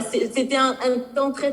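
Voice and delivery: ordinary delivery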